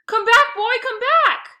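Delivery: very high, loud desperate call; panicked calling after the dog; desperate yell. A narrator's reading. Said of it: "I whimpered".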